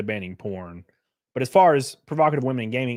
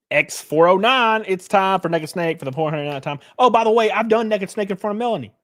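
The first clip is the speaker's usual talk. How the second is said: excited; 6 semitones higher, 4 dB louder than usual. Real, not acted. Hyped announcement to chat.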